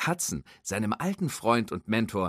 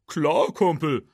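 high, louder, slow drawling tow-truck voice; folksy tow truck; 8 semitones higher, 6 dB louder, slower than usual. Acted. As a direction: tow truck Hook teasing his friend, high and drawling. Hook's voice.

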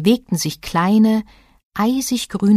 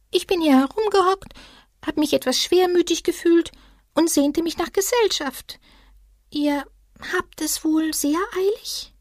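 melancholy little creature; 12 semitones higher than usual. A narrator's reading. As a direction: sound a bit gloomy and small. Voices the small creature.

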